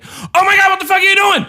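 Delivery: high, fast outraged shriek; yelling at a kid. Acted. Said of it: "I should never yell at another kid".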